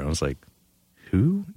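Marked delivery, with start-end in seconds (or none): 1.1-1.5 high, rising puzzled query; baffled question. Retold or imitated. Stagehand hearing an unknown star's name, baffled. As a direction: imitate being clueless, pitched way up.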